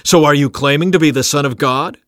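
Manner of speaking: the council shouting down the prisoner. A narrator's reading. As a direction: hurl the question at him. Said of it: "They all shouted".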